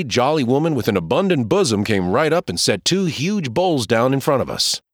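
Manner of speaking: ordinary delivery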